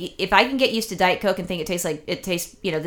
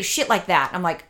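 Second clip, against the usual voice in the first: normal talking voice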